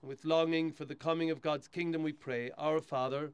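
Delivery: steady, solemn prayer reading; hushed graveside prayer. Acted. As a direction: lead the mourners in prayer. Priest leads graveside burial rite.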